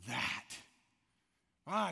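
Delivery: ordinary delivery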